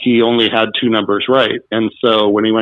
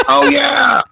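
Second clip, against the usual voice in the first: excited exclamation; 14 semitones higher than usual. Retold or imitated. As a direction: shout it like a sudden memory clicked. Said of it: "And he was like".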